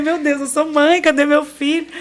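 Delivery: suddenly realizing she's a mother; alarmed exclamation. Retold or imitated. Waking up, asking for baby. (exclamação alta e assustada)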